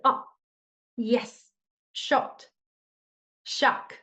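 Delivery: short breathy punched syllables; like a punch to the belly; neutral tone feeling. Shown as a demonstration. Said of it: "like someone punch you belly".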